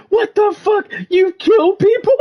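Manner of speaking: shrill, high, stunned yelp of disbelief; high-pitched appalled cry; shocked outburst. Retold or imitated. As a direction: squeal it in horrified shock. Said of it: "and he's like".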